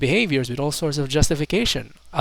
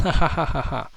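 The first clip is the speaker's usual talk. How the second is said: deadpan "ha ha ha" read-out of laughter; mock laugh. Reading out a commenter who just laughs, mock laughing. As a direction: imitate a typed-out laugh. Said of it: "Someone just laughs".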